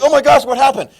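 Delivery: high, loud startled cry; shocked cry. Retold or imitated. Soaked guest crying out in shock, high and loud. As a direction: yelp it as cold water hits you. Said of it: "you're in shock".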